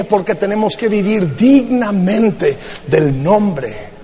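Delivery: ordinary delivery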